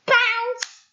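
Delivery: high shouted sound word; high loud bounce effect. A narrator's reading.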